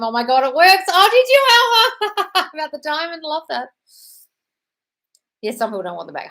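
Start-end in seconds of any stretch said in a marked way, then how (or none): 0.0-0.8 mild mock squeal, louder; a reading of a thrilled shriek; excited scream. Reading a viewer's excited bathroom scream, mild mock squeal. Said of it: "run to ladies' room to scream". 0.9-3.7 delighted squeal. Real, not acted. Delighted reaction to viewer story.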